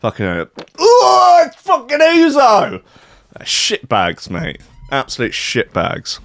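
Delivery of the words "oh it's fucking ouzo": high, loud retching outcry, drawn out; mock anguished shout, slowed; disgusted outcry. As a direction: yelp in revulsion at the taste. Discovers the water is ouzo.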